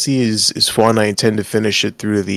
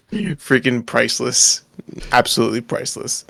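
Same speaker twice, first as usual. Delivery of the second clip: ordinary talk, nothing marked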